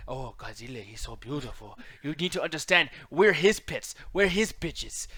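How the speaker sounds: mock Japanese accent; caricatured Japanese accent, starting low and hushed; solemn movie-sage impression with hammed-up Japanese accent; Ken Watanabe. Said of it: "but Ken Watanabe, that motherfucker".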